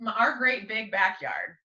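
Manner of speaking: ordinary talk, nothing marked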